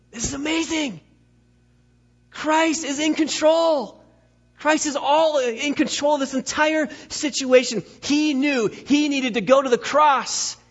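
high, drawn-out emphatic preaching; full-force preaching. Real, not acted. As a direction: preach it high and slow, landing each big word.

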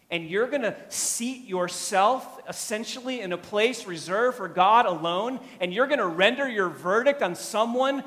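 loud, high, emphatic rebuke; indignant challenge. Real, not acted. Preacher challenging those who take God's place as judge, indignant.

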